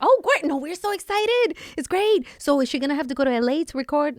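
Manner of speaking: high, bright-sounding loud eagerness; eager phone voice. Retold or imitated. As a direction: gush politely to the caller. Husband negotiating on the phone.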